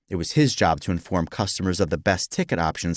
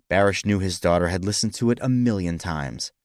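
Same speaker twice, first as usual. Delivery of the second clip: usual speaking voice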